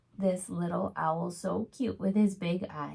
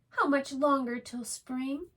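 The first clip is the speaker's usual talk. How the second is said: impatient little owl; 7 semitones higher than usual. Acted. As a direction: plead to know when spring comes. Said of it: "Little Owl asked".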